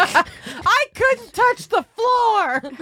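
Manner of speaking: high, drawn-out whine; squeaky upset whine over the hotel. Real, not acted. Complaining about hotel.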